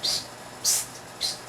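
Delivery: soft unvoiced psst sounds to show the lisp; testing a lisp with whispered psst. Shown as a demonstration. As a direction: hiss out a few quiet psst sounds. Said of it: "I got too much of a lisp?".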